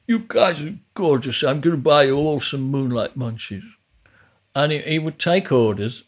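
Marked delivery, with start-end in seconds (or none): 0.1-3.8 lightly voiced, gushing studio owner; a hint of the doting drunk landlord; imitating Chris Suma. Owner offering the band burgers, lightly mimicked. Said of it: "and he'd just say".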